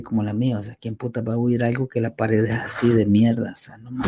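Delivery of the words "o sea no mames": soft unvoiced whisper. Real, not acted. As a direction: breathe it out as an aside. Whispered complaint about the wall.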